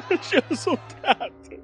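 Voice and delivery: high, tearful wounded cry; sobbing in pain. Acted. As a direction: sob it through the pain. Wounded, crying. (chorando de dor)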